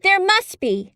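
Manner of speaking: protesting in disbelief; surprised cry. Acted. Mary insists.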